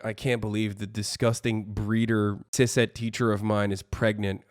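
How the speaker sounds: low, soft deadpan revulsion; understated mocking disgust. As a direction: parody a prudish complainer in a low, muted voice. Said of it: "That's what you sound like".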